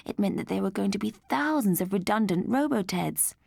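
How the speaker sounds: plain reading voice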